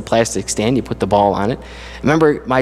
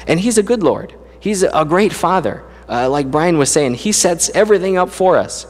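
preaching with mounting fervour, pitched higher; rising preacher voice; 5 semitones higher than usual. Acted. Preacher telling his congregation what God gives them, fervent and building. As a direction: lift the congregation with each line, building.

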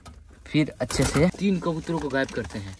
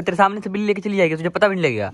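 fast, louder and higher accusation; rapid raised rebuke at a careless friend; angry scolding; 7 semitones higher, 7 dB louder, faster than usual. Acted. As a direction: tear into him for letting it happen. Staged quarrel, blaming friend.

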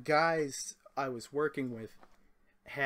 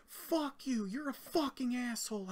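much higher mock drunk yelling; drunk coworker insulting; 9 semitones higher, slower than usual. Drunk coworker cursing the driver, high mock yell. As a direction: mimic a drunk guy cursing you out, pitched way up. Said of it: "he's like".

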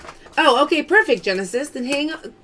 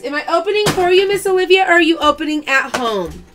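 ordinary talk, nothing marked

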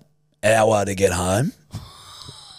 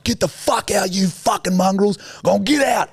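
yelling at dogs; 11 semitones higher than usual. Retold or imitated. Shooing stray dogs away from the tuk-tuk with a high yell. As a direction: chase off the mongrels, voice pitched way up.

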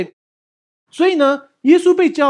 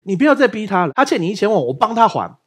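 protective outburst; 5 semitones higher, 5 dB louder than usual. Retold or imitated. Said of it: "我就冲出来说".

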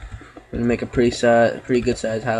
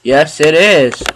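excited; 4 semitones higher, 7 dB louder, slower than usual. Real, not acted. Daylight arrives, escaping night.

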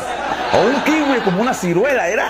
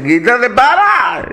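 slow, louder drawn-out command; yelled stop; 3 semitones higher, 6 dB louder, much slower than usual. Acted. Rider ordering the camel to stop, slow and loud.

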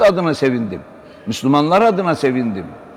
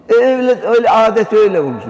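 higher, louder, fast shrugging excuse; mock careless youth; 7 semitones higher, 5 dB louder, faster than usual. Preacher mimics a youth excusing New Year habits, higher and hurried. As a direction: toss off the excuse like a careless kid.

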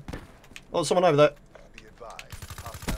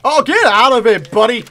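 loud taunt; 8 dB louder than usual. Real, not acted. Gamer yelling at enemy player.

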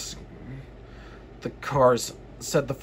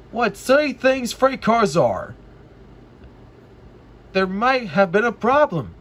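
scoffing engine; 6 semitones higher, 3 dB louder than usual. Acted. James scoffs at cars.

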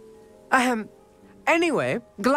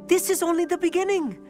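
excited promise; 8 semitones higher than usual. Acted. Excited about more tables to find.

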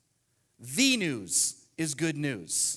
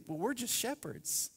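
imagined shepherds; 4 semitones higher, 7 dB quieter than usual. Retold or imitated. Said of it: "I can imagine the shepherds thinking".

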